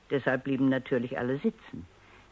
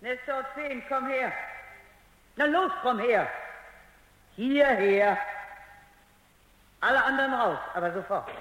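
high, raised calls across the room; calling out commands; 9 semitones higher than usual. Acted. Doctor ordering the robots across the hall, raised commanding call. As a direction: summon the robot from a distance, firm.

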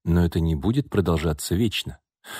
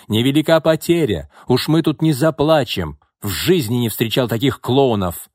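high, loud, scornful retort; yelling back; 5 semitones higher, 4 dB louder than usual. A narrator's reading. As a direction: shout back at a quitting colleague. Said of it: "вопил в ответ Левин". (сердито вопить в ответ)

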